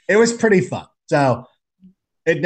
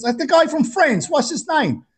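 Australian guy; 13 semitones higher than usual. Retold or imitated. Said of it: "He goes".

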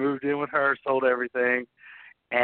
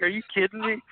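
laughing through the question, pitched up; half-laughing disbelief at the news; 10 semitones higher, faster than usual. Retold or imitated. Husband answering his wife's phone call, laughing. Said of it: "I'm, like, cracking up".

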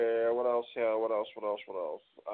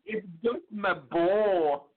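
put-on high, slow Scottish child voice; a wee Scottish boy pleading over his ball; Scottish Brave accent; 8 semitones higher, much slower than usual. Host repeats Gideon's line in a high Scottish accent. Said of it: "any chance to do a Scottish slash Brave accent".

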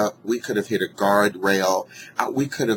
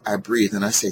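ordinary talk, nothing marked

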